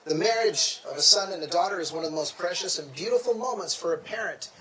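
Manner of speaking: loud, ceremonial officiant address; formal speech. Acted. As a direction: project it to the whole hall.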